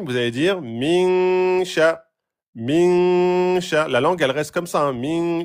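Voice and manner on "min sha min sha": min sha, n held two beats; two-beat noun. Shown as a demonstration. Teacher showing students the two-beat noun. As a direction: stretch the n of "min" before "sha". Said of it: "au lieu de dire min".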